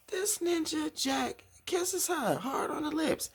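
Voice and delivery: very high, drawn-out disbelief; scandalized reveal. Real, not acted. Recapper revealing Jack kissed Leah, scandalized. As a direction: squeal the gossip slowly, savoring it. Relishing the shocking kiss reveal.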